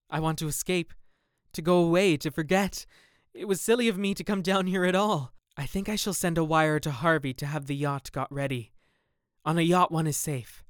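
building to a frantic escape wish; desperate. A narrator's reading. Dorian desperate to flee.

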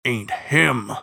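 sepulchral hound, a touch of howl on HIM; restrained howl in a grave voice; furious howl creeping in. A narrator's reading. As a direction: let fury creep into "ain't him". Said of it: "furious howl creeping into his sepulchral voice".

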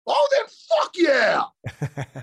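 loud, high, drawn-out cheer; excited yell. Real, not acted. Co-host agreeing to leave it in, exuberant yell. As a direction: yell it with every word stretched out.

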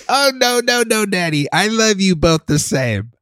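high-pitched imitation of a young child; sweet kid protesting, slowing at the end; little daughter. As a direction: put on a high little-girl voice. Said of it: "And she's like".

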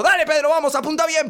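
high, loud coaching yell; pushy dad at the arcade; cheering on. Acted. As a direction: shout urgent encouragement at a little kid. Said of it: "Y le digo".

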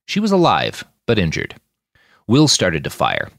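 ordinary delivery, nothing marked

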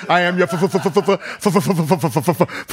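stammering movie villain, stuck on f; machine-gun the f, never reaching the word; stuttering Darth Vader. Acted. Riffing on Vader revealing fatherhood, stuttering. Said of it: "the voice of Darth Vader".

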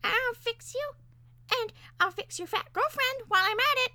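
very high, squeaky menace; threatening parakeet. A narrator's reading. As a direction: threaten spitefully in a tiny bird voice. Parakeet threatens both humans.

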